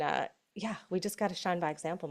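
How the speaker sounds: ordinary delivery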